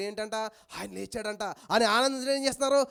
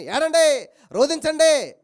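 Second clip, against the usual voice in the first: 1 mocking call to weep; 5 semitones higher, 8 dB louder, much slower than usual. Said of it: "అంతేగాని".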